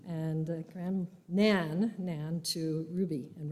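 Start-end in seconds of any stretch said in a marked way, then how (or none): none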